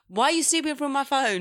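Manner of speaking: high, loud mock accusation; acted row. Host playing the partner accused over an ex, mock indignant. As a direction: fire back high and loud, play-arguing. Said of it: "Me and Tam just give you a little act".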